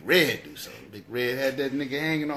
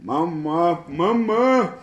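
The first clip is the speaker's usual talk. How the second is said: dusty mummy rasp; 4 semitones higher, 7 dB louder, much slower than usual. Retold or imitated. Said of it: "sounded like a mummy".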